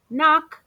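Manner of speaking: loud, high knocking call. A narrator's reading. Narrator knocks at Amu's door.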